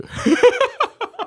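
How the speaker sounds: a high, falling giggle. Real, not acted. Laughing at her old vow never to host a wedding, a high giggle. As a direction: giggle at your own words.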